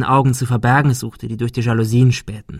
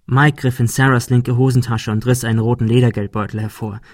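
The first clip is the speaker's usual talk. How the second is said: usual speaking voice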